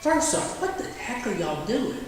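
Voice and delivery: sudden high-pitched exasperated "First off"; a sergeant catching his men goofing off, half mock outrage; exasperated callout. Catching his soldiers playing cornhole, high exasperated callout.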